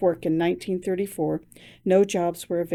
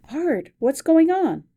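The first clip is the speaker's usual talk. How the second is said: worried; 8 semitones higher, slower than usual. Acted. Worried wife sensing bad news.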